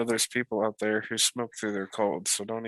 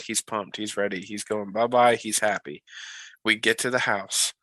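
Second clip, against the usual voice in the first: ordinary delivery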